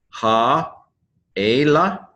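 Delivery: "Ha-ela" split by a long pause; syllable by syllable. Shown as a demonstration. Teacher introducing a Hebrew word, syllable by syllable. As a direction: sound out "Ha", wait, then "ela". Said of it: "accent on the first syllable".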